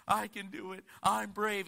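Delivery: put-on a small girl psyching herself up; little girl bravely. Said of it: "and she says".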